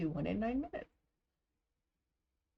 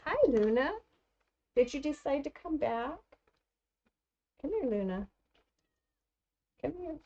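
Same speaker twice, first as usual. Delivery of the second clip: pet talk; 8 semitones higher than usual. Real, not acted. Calling the dog Luna.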